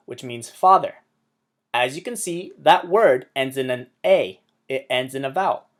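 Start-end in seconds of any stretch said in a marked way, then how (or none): none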